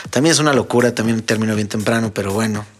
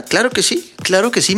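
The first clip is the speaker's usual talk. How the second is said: eager yes; 8 semitones higher than usual. Retold or imitated. Eager reply to wife's request.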